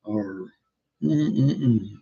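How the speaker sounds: ordinary talk, nothing marked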